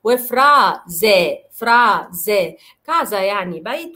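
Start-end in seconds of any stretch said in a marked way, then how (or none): 0.0-2.6 وفرازي said slowly with a buzzing z; teacher models فرازي slowly with z; s voiced as z. Shown as a demonstration. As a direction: draw out فرازي with z between vowels. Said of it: "بتنطق ز".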